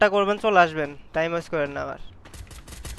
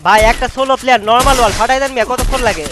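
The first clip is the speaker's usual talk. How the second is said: loud, higher urgent yell; urgent shout; 4 semitones higher, 10 dB louder than usual. Real, not acted. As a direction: yell at them to push. Urging teammates mid-fight.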